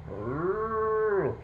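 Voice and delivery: long, high rounded moo; cow mooing. A narrator's reading. Storyteller voicing the cow in the yard, long moo. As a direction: imitate a cow with one sustained moo. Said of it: "He heard the cow out in the yard going".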